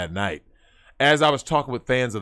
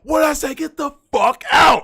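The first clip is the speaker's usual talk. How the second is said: bouncer shouting; 13 semitones higher, 5 dB louder than usual. Retold or imitated. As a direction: bellow it like a bouncer clearing the room. Bouncer throwing them out.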